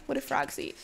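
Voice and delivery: lively storybook voice with animal voices; reading to children. Acted. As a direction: read it like a bedtime picture book. Reads picture book aloud.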